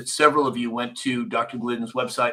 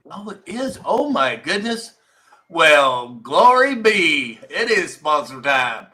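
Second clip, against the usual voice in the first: hammed-up Southern drawl, higher and louder, slowed down; mock Southern "glory be" exclamation; southern accent; 4 semitones higher, 8 dB louder, slower than usual. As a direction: play a surprised Southern belle, high and loud, with slow drawn vowels. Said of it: "that was my southern accent".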